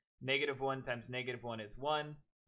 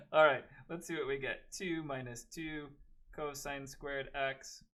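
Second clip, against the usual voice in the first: usual speaking voice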